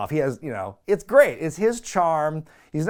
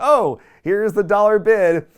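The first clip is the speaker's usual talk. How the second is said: loud host call; 7 semitones higher, 9 dB louder than usual. Retold or imitated. Barker announcing bid.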